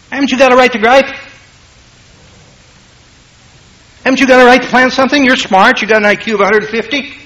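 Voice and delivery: high, fast voice of the tempter; tempting Satan. Acted.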